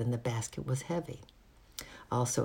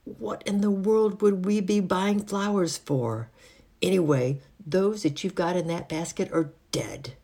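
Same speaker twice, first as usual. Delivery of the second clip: higher, indignant housemaid voice; 5 semitones higher, 3 dB louder than usual. A narrator's reading. Housemaid turns away the young flower sellers, indignant. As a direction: scold them with haughty disbelief.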